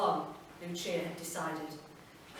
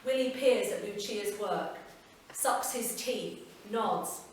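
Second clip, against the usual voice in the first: ordinary delivery, nothing marked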